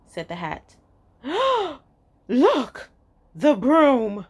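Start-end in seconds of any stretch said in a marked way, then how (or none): 1.2-1.7 quick high gasp of alarm; startled gasp. Acted. Bat sees the broom coming, sharp high gasp. 2.3-4.3 louder, alarmed bat cry; bat cries out. Acted. Bat warns the others about the broom, loud alarmed cry. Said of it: "cried the bat".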